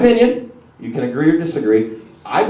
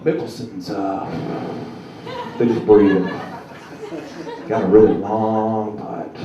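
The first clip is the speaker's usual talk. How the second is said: golf announcer; 5 semitones lower, slower than usual. Retold or imitated. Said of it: "golf announcers".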